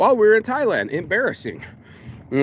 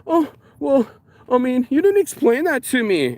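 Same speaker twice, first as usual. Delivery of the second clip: higher, put-on whiny excuse; 9 semitones higher than usual. Mocking the client who changed the order, whiny. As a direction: mimic a flustered client dodging blame. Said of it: "And it was like".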